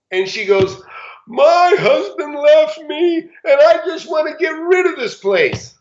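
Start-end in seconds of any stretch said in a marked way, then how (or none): 1.3-5.7 high, slow, tearful plea; retold sobbing wife, higher and drawn out. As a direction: imitate a woman breaking into tears. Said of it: "She burst into tears".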